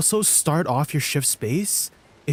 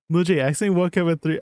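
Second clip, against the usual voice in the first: rattled off fast and a bit louder, close to laughing; fast, amused reread; 4 semitones higher, 4 dB louder, much faster than usual. Retold or imitated. Streamer rereads a silly chat question, fast and amused. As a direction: race through it again, barely holding back a laugh.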